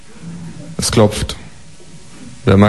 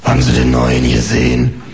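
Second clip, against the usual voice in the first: much lower gossiping neighbour; nosy neighbour; 9 semitones lower than usual. Acted. Neighbour from downstairs gossiping about the newcomer, low.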